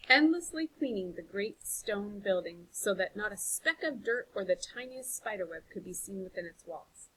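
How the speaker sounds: plain reading voice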